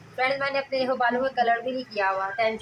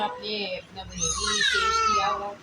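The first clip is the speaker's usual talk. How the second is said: whiny complaint; 11 semitones higher than usual. Acted. Sister complains she is sulking.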